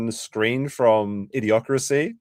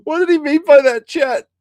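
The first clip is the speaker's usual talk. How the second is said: laughing; 5 dB louder than usual. Real, not acted. Laughing hard at clip.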